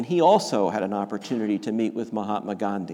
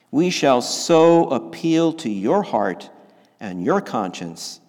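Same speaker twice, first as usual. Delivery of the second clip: preaching at full force; 5 dB louder than usual. Acted. Preaching King's quote with force.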